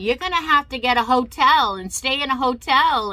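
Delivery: loud, high imitation of an angry order; retold yelling. Guest services lady ordering the patient's best friend, imitated yelling. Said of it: "she was yelling at my bestie saying".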